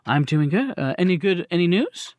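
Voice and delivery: lightly voiced Miss Victoza. GM plays Miss Victoza welcoming the visitors, lightly voiced and hesitant.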